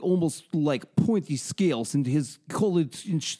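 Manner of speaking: slow hammed-up Russian accent. Parodying Putin describing the queen, comic Russian accent. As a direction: relish each word in a mock Russian accent. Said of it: "I love your accent".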